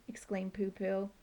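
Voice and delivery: plain reading voice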